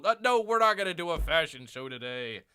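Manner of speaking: usual speaking voice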